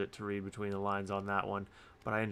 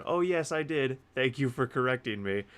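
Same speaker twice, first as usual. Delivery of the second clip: lightly voiced eager reply, pitched up; grateful friend, imitated; 4 semitones higher, 3 dB louder than usual. Poet friend thanking the reviewer for corrections, lightly imitated gratitude.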